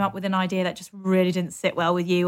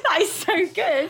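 high squeal of laughter, then slow praise; laughing while praising the accent; laughing delight; 10 semitones higher, slower than usual. Real, not acted. Host reacting to the guests' Southern impression, squealing with laughter. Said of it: "That is a really good accent".

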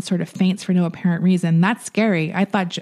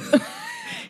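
real high-pitched laugh; 6 dB quieter than usual. Real, not acted. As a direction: burst out in a high giggle. Laughs at retold line.

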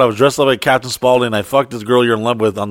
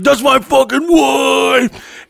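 high, slow, dragged-out shout; mock husband yell; 12 semitones higher, much slower than usual. Imitating the tiny husband shouting in slow mock outrage. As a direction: wail it like the outraged little husband. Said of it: "short little husband guy's like".

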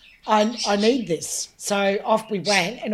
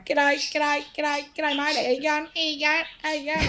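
higher, softer repeated hellos, imitated; casual pub greetings; 5 semitones higher, 5 dB quieter than usual. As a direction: toss quick g'days to strangers along the bar. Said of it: "Like this".

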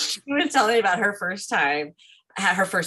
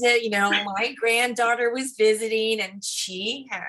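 gossiping grandma; 2 semitones higher than usual. Retold or imitated.

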